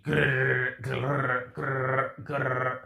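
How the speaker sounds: rolled rattling vocal gun bursts; imitated machine gun. Storyteller acts out an LMG firing, imitated gun rattle. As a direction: trill out rounds of automatic fire.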